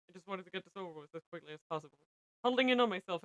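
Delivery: plain reading voice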